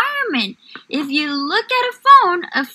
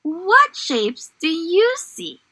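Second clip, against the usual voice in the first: gentle storybook read-aloud; reading to children. Acted. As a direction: read it like a picture book for little ones. Teacher reads story to class.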